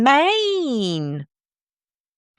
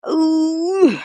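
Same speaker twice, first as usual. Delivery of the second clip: effortful ooh; 9 semitones higher than usual. Real, not acted. Squeaky ooh while climbing onto a cushion.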